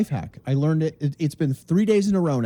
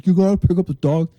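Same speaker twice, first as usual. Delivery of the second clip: mock complaining commenter; 5 dB louder, faster than usual. Imitating Tina's complaint, louder and faster.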